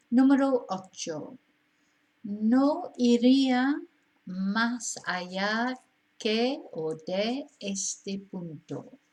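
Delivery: slow, deliberate dictation; slow model reading. Shown as a demonstration. Teacher reading exercise eight to learners, slow and careful. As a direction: read each word out slowly and clearly. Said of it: "teacher reading exercise sentence aloud".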